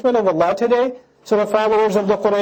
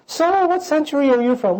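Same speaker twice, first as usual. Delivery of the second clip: curious chatter; 7 semitones higher than usual. Acted. Chatting in Jannah.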